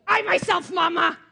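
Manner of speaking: put-on loud, high-pitched toddler outburst; indignant toddler. Daughter refusing mom's help dressing, indignant toddler yell. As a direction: yell it like a stubborn two-year-old. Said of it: "And she'd go".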